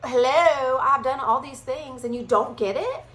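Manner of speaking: high, loud, stretched "hello"; exasperated Jesus. Retold or imitated. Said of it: "And so he's like".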